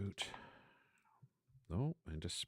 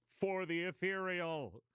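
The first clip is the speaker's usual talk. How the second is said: high-pitched, drawn-out war cry; battle cry yell; 13 semitones higher, 3 dB louder, slower than usual. Acted. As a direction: yell it like charging warriors, stretched out. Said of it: "all yelling".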